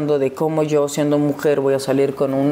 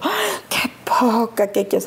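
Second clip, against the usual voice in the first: inner indignation; 4 semitones higher, slower than usual. Retold or imitated. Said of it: "Yo por dentro".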